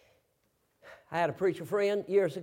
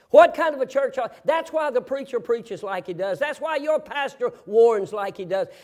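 loud, raised, driving sermon voice; full-force preaching; 5 semitones higher, 8 dB louder than usual. Real, not acted. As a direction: drive it home to the congregation, building in force.

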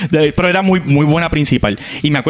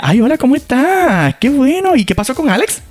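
sweet new teacher; 10 semitones higher than usual. Retold or imitated. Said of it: "Y ella".

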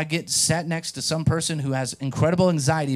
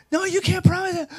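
panicky passenger; 3 dB louder than usual. Retold or imitated. Anxious flyer pleading with the pastor, high panicked whine. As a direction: play the frantic seatmate, pitched way up. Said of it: "working herself into a frenzy".

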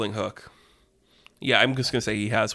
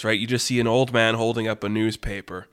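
ordinary delivery, nothing marked

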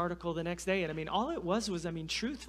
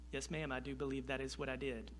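meek; 4 semitones lower, 9 dB quieter than usual. Retold or imitated. Meek reply to scolding wife.

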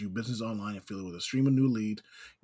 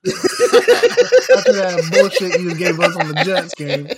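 much louder, slow laughing jab; 7 semitones higher, 15 dB louder, slower than usual. Real, not acted. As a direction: laugh it out at him.

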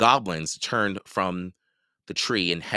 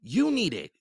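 put-on higher girl's protest; 11 semitones higher than usual. A narrator's reading. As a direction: push it back. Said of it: "she protested".

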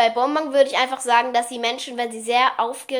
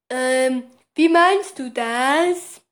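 dumb little guy voice; 6 dB louder, much slower than usual. Retold or imitated. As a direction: sound completely clueless. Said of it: "Lolly hat eine bisschen komische Stimme".

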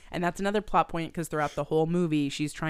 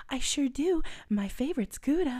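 put-on chirpy girly voice; 9 semitones higher than usual. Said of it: "she's like".

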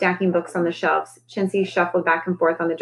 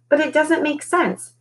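puzzled magician; 9 semitones higher than usual. Acted. Magician character puzzled, voiced differently.

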